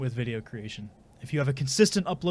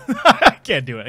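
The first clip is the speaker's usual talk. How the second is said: ordinary delivery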